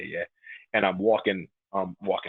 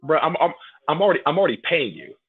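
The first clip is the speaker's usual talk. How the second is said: higher, louder aggrieved complaint; mock protest; 8 semitones higher, 6 dB louder than usual. Diner arguing with hot wings he already paid for, mock indignation. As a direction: plead indignantly, playing up the grievance. Acted protest to his food.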